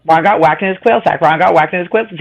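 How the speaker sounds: "quill sack" slipping out for "quail sack" at speed; fast repeats. Shown as a demonstration. Trying the twister five times fast for the group. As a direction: rattle it off until the tongue slips. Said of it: "Show us how to do it".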